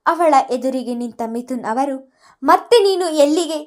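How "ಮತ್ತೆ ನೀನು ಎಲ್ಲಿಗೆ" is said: higher, louder, seething challenge; furious. Acted. Mithun confronting Sonali at the door, furious and loud. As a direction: spit the words out in rage. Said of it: "ಕಣ್ಣಿನಲ್ಲಿ ಕೆಂಡ ಉಗುಳುತ್ತಾ".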